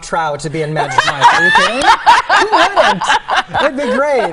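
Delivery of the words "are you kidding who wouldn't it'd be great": high, loud and giddy; excited squeal. Real, not acted. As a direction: squeal it with delight. Eager to join Magic Mike.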